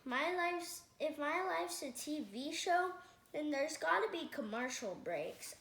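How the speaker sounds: ordinary delivery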